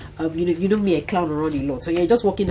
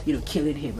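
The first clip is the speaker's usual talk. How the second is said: normal talking voice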